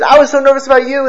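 a parent's voice lifted with relief; relieved father. Retold or imitated. Father to son come home, high and relieved.

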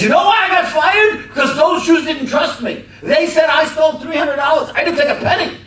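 high, loud furious outburst; Paul shouting back at the stranger in the crowded shop; angry shout. Retold or imitated. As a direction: yell it, stung and defensive. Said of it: "now this guy gets angry".

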